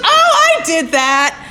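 very high, laughing squeal; Sonia laughing. Retold or imitated. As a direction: shriek with amused delight. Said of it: "She goes".

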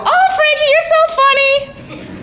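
very high, laughing, delighted squeal; Sonia laughing. Retold or imitated. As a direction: squeal it like she can't stop cracking up. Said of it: "my sponsor would go".

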